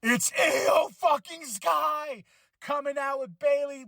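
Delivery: high, slow, thrilled shout; excited yell. Retold or imitated. Vlogger retelling his reaction to Io Sky's arrival, an excited high shout. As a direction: roar it like the big surprise just hit. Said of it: "I was like".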